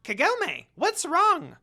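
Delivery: high, loud fretful squawk; worried Jakin. Acted. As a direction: fuss anxiously like a little creature. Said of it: "Jakin asked worryingly".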